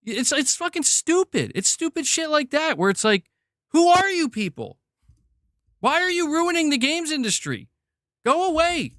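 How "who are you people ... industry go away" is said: much higher, pleading outburst at the critics; exasperated mock outcry. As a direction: throw up your hands and beg them to leave. Ranting at woke critics.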